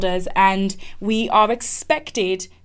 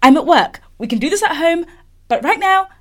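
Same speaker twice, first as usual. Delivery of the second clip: high, louder, climbing in pitch; mock panicked self-talk; 7 semitones higher, 6 dB louder than usual. She tells herself not to cry at work, mock panic. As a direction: plead with yourself, tipping into a laugh.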